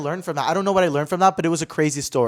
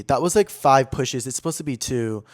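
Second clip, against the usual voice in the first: ordinary talk, nothing marked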